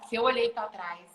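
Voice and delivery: ordinary talk, nothing marked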